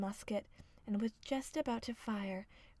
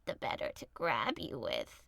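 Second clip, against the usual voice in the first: far lower, rumbling wolf; deep wolf voice; 11 semitones lower, 4 dB louder than usual. A narrator's reading. Wolf in grandmother's bed, far lower. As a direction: growl it low as the wolf.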